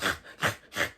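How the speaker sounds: a short airless laugh at herself; breathy laugh. Real, not acted. Storyteller laughs about her own snotty bawling, breathy laugh.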